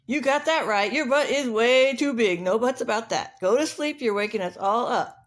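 punchy, insulting rooster voice; scolding rooster. Acted. Rooster telling rhino to go to sleep, scolding. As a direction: tell him off like a cocky rooster.

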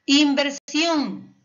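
Inversión slow and loud, leaning on SIÓN; stress on last syllable. Shown as a demonstration. Teacher showing the stressed last syllable, Inversión drawn out on SIÓN. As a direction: stretch and punch the SIÓN in Inversión. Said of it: "última sílaba".

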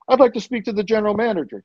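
high, hurried guest request; quick demand. Retold or imitated. First quick request at front desk.

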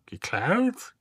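robot apes the question with disdain; mocking echo. Marvin mockingly echoes Ford's question, sneering imitation. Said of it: "äffte Marvin sie nach".

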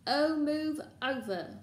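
high, loud, drawn-out exasperation; impatient snarl. A narrator's reading. Hermione pushing to the locked door, exasperated snarl. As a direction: shove past them with your voice. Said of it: "Hermione snarled".

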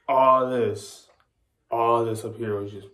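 lips held by fingers; slightly muffled lip sounds; speaking with fingers on lips. Real, not acted. Seen 0: Fingertips press at lips from -2.0 to 1.3, then gesturing.

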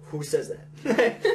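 loud, slow retold incredulity; dawning disbelief. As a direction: draw it out as if just realizing. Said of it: "someone saying that, realizing".

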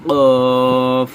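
কফ loud from the back of the tongue; Arabic ق loud and guttural; deep throat qaf. Shown as a demonstration. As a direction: hit কফ hard from the throat. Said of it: "এখান থেকে".

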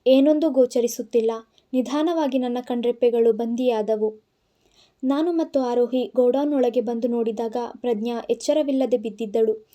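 ordinary delivery, nothing marked

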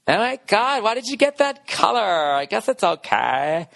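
put-on high, snotty teen voice that drags out the last line slowly; mock a spoiled girl let down by her new Bentley; spoiled rich girl. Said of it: "Like some hot chick that gets".

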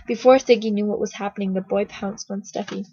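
usual speaking voice

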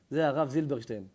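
usual speaking voice

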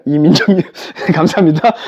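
ordinary talk

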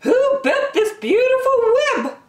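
high, loud owl character voice; very high-pitched talking owl; hooting owl. Acted. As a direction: ask it with a hooty owl sound. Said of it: "asked the owl".

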